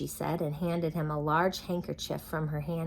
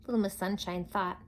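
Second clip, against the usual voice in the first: ordinary delivery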